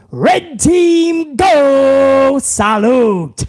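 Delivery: slow, drawn-out rally cry; catchphrase call. Pretend. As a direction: stretch it like a chant. Chanting a fan catchphrase.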